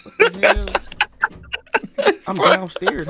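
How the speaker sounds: loud startled what the hell; sudden alarmed yelling; startled outburst. Real, not acted. Startled by a fly indoors.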